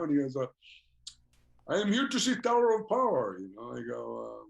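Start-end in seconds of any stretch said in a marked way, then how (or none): 1.7-3.4 higher, louder Russian fan voice; imitating the Russian fan. Fan telling the singer why he came, higher imitated voice. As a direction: play the earnest fan who flew in for the band.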